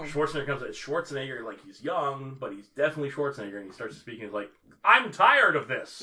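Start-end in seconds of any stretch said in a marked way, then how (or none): 4.8-6.0 loud, slow, raised movie-hero delivery; dubbed matinee voice. Retold or imitated. Podcaster imitating a dubbed bodybuilder's first film, slow projected matinee voice. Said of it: "dubbed him over with just a regular, like, matinee voice".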